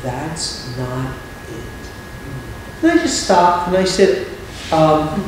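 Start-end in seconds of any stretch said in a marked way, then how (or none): 0.0-2.8 hushed, slow and very soft; soft, slow voice of the Lord. Retold or imitated. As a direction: barely breathe the words. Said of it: "the Lord very softly said".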